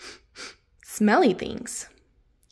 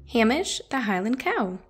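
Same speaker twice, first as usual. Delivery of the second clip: plain reading voice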